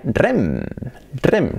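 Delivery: "rem" as one word, rolled R; Spanish-style RREM; Spanish pronunciation. Shown as a demonstration. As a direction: say rem as a single trilled syllable. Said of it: "yo decía".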